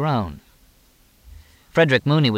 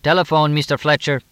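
raised, higher-pitched call; 6 semitones higher, 5 dB louder than usual. A narrator's reading. Lopez calls Fletcher in from the back door, calling out. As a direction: call it out to someone outside. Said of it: "Lopez called from the back door".

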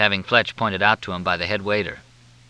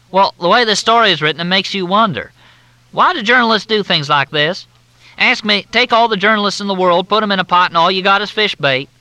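high, loud, syrupy Southern drawl; Southern officer on the phone, in a thick drawl; 12 semitones higher, 6 dB louder than usual. Acted. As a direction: drawl it out like a courteous Southern major. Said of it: "The drawl was thicker than Mississippi mud".

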